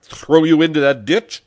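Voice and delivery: loud, high, building to a shout; howled threat. A narrator's reading. Pepone threatening the little man with a ditch, howling. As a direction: threaten him, rising to a yell. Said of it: "howled Pepone".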